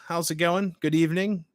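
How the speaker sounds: ordinary talk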